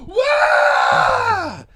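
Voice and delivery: high shouted crowd whoop; crowd cheer. Retold or imitated. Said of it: "the whole crowd goes".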